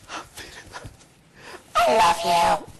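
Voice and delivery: hammed-up choked sob breaking into a cry; tearful gushing. Man gushing at his departing friend, mock tearful choking. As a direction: choke up, then wail it out.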